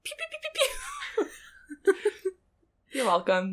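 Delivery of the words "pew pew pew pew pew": high laughing laser pews; pew sound effects. Pretend. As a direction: blaster noises with a laugh. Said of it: "Whenever he ejaculates".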